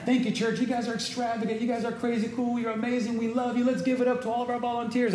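high, gushing cheer for the volunteers; excited praise. Real, not acted. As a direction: hype up the church like a cheerleader.

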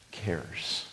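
whispered word. Real, not acted. Preacher stressing that God cares, whispered. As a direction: breathe it softly.

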